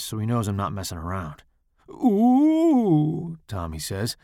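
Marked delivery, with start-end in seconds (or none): 1.9-3.4 high, put-on taunting ooh; mocking ooh. A narrator's reading. Tommy mocks Charles's warning, high taunting ooh. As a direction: tease him like he's a pushover.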